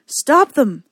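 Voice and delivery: loud urgent shout. A narrator's reading. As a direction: yell the order. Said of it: "he shouted".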